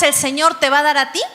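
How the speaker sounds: ordinary talk, nothing marked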